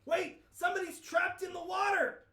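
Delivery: high, loud alarm; urgent shout. Acted. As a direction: cry out that someone's drowning.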